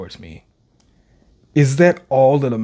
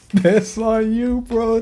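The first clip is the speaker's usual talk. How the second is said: mock taunt; 9 semitones higher, slower than usual. Real, not acted. Taunting Sukuna.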